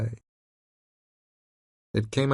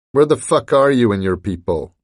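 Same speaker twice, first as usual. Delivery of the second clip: raised, gruff boss demand; angry demand; 3 semitones higher, 7 dB louder than usual. A narrator's reading. Roth demanding answers from Talbot, angry and loud. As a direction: bark it at the hired man. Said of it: "asked Roth".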